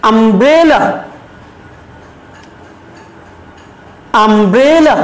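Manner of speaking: "umbrella" with a long, stressed middle syllable; stress on BRE. Shown as a demonstration. Teacher leads students in saying "umbrella", middle syllable stressed. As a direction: swell on the middle of "umbrella", then fall away. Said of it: "সেই অংশটুকুতে আমরা জোর দিব".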